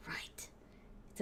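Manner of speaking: barely voiced, very soft whisper; secretive, breath-only murmur; hushed whisper. Real, not acted. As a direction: let the word fall away into a whisper. Dreamy candlelit writing, drops to whisper.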